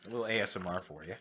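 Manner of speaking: talking while crunching chips. Real, not acted. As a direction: mouth full of chips. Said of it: "we're eating chips, so sorry for the crunch".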